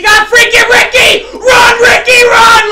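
high, loud cheering a runner on; real excited yell at the screen; hyped shout. Real, not acted. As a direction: scream him down the field. Big run by his player.

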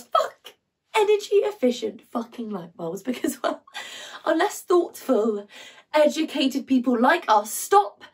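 frustrated rant, pitch jumping high on the swearing; exasperated monologue character. Acted. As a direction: rant in disbelief with halting pauses. Performs monologue from Lungs.

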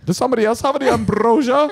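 very high put-on party-guest voice; dinner guest character. Diner deflecting an argument, high put-on voice. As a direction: pipe it up high to change the subject. Playing changing subject at family dinner.

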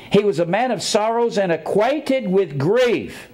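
solemn verse reading; scripture reading. Acted. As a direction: recite it with weight.

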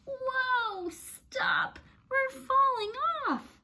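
high, loud storybook squeal; squealing animals. A narrator's reading. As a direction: squeal like you're sliding off a horse. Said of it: "squealed Cat and Dog and Pig and Duck".

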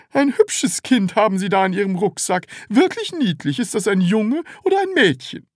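high, sugary salesman voice; forced charm. A narrator's reading. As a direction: gush with fake politeness.

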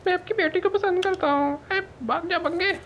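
silly, very high falsetto; mock squeaky proposal. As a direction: play the suitor scene in a squeaky joke voice. Mock proposal and father's refusal. (मज़ाकिया पतली, ऊँची आवाज़)

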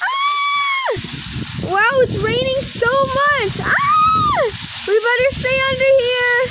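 very high screams between high exclamations; squealing in rain. Acted. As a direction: act caught out in heavy rain. Doll squeals in pretend downpour.

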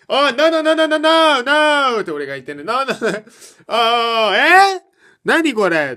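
high, loud stuttering panic; panicked stammer. Retold or imitated. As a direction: stammer in shocked embarrassment. (動揺してどもる)

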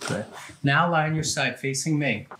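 usual speaking voice

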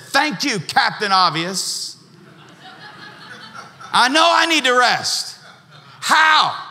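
high, mocking "Captain Obvious", then a laughing, exasperated shout of "How?"; sarcastic retort. Retelling how he snapped back at his mentor, sarcastic and exasperated. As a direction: throw the obvious advice back, rising to a yell.

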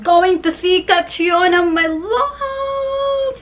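gush, rising high on the pet name; excited gushing. Real, not acted. Fan going to see Catriona, gushing squeal. Gushing over her idol.

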